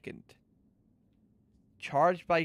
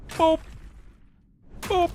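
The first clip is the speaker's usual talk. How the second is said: high, squeaky boop noises; playful boop; 7 dB louder than usual. Real, not acted. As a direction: chirp each boop. Playful sound effects.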